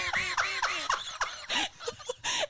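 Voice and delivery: long rising burst of laughter; big high laugh. Real, not acted. Big laugh after punchline.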